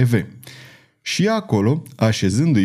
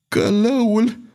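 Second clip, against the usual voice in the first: horrified cry; 11 semitones higher, slower than usual. A narrator's reading. Duke horrified at the word.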